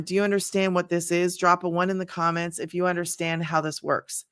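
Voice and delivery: ordinary talk, nothing marked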